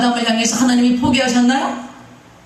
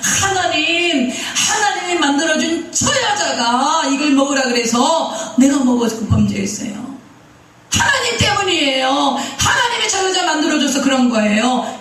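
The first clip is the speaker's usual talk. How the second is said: Adam blaming God; 4 semitones higher than usual. Acted. Adam blames God for the woman He made, in protest. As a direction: act out Adam's whining excuse, pitched up. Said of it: "아담이 거절하는 거예요".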